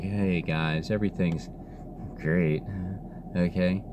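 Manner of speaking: very soft, low elite talk; Gary Perry. Retold or imitated. Mocking how the elite talk to the guys, soft and low. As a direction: imitate their hushed, low-key patter. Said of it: "They talk like Gary Perry".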